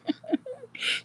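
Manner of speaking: a high, breathy giggle. Real, not acted. Laughs after teasing Rhonda.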